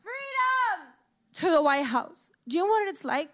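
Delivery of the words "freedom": high, drawn-out protest cry; retold scream, pitched way up; imitated screaming. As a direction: call it out like the crowd did. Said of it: "they were fucking screaming".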